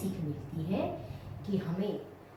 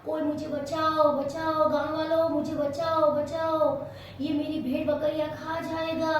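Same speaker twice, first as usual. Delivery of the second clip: higher, louder mock alarm calls; fake cry for help; 7 semitones higher, 4 dB louder than usual. Gopi falsely calls villagers for help, loud mock panic. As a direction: pretend panic, yelling for the village. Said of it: "जोर-जोर से चिल्लाने लगा".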